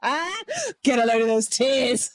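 high, slow mock gawking voice. Mocking a viewer of the crying lady, high and drawn out. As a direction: ham up a leering viewer, drawn out.

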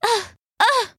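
high, hammed-up coughing; theatrical fake cough, high; dramatic cough. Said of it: "coughed the adder dramatically".

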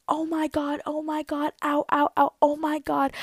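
high-pitched mock panic; retold yelps of embarrassed pain; freaking out. Player clutching the fence, freaking out. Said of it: "I'm freaking out".

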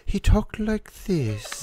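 Edgar Allan Poe voice. Acted. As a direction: drag it out like a gloomy poet. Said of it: "your Edgar Allan Poe voice".

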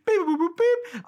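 high, loud chirpy robot beeping; robot beeps. Retold or imitated. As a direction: beep it out like a cartoon robot. Imitating robot noises.

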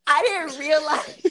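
laughing. Real, not acted. Giggling while talking to co-hosts.

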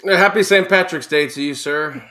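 a clumsy stab at an Irish lilt for Saint Patrick's Day; Irish accent; bad Irish accent. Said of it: "that was not a good Irish voice".

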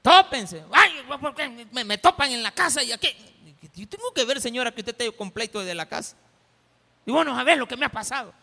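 shrill, loud complaining rant; angry shout. Acted. Preacher acting a bus passenger yelling at the fare collector. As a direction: snap at everyone, fed up.

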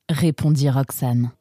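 usual speaking voice

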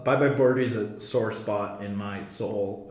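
ordinary talk, nothing marked

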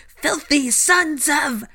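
high, loud, drawn-out shriek; shrieking harridan, high and loud; screeched insult. Acted. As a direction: screech it slowly in outrage. Portrait screech, cut off mid-insult.

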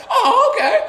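put-on high, chirpy kid voice; very high childlike oh okay; little kid. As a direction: play a carefree little boy.

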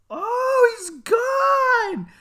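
high, loud mock wail; imitated panicking mom. Mom finds brother missing, imitated panicked wail. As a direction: scream in hammed-up horror.

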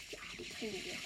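hushed whisper to himself; soft unvoiced confidence. Real, not acted. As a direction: breathe it out under the breath. Muttered confidence mid-round.

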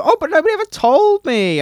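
mocking high-pitched voice; high whiny mimic. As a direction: whine it like a kid. Mimicking friend's excuse.

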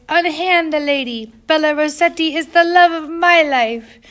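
teasing friend imitating a boy's squeaky declaration; pipsqueak scream. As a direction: ham it up as a lovesick pipsqueak. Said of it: "scream in his pipsqueak voice".

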